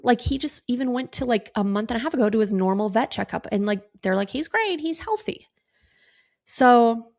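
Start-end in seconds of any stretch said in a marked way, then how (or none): 4.3-5.5 imitating vets in a high, light voice; mock all-clear, pitched way up; breezy reassurance. Quoting vets' all-clear on dog.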